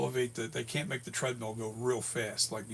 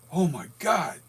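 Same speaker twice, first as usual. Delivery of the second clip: higher, louder alarmed gasp of words; startled exclamation; 5 semitones higher, 7 dB louder than usual. Retold or imitated. Telling how his heart raced, a startled oh my God. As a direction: blurt it out in shock.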